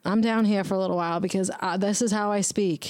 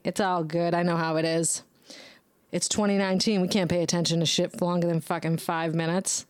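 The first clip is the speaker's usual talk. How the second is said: ordinary delivery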